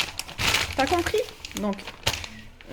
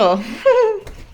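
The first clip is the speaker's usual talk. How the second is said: high laugh; 7 semitones higher than usual. Real, not acted. Laughs after mock threat.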